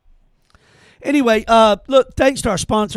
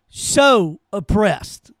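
slow sarcastic drawl; much slower than usual. Acted. Host repeating the jab at [name], slow and sarcastic. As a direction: stretch "So oppressed" out slowly, mock pity.

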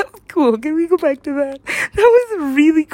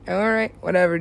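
ordinary talk, nothing marked

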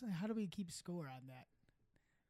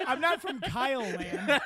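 laughing protest; 4 semitones higher, 9 dB louder than usual. Real, not acted.

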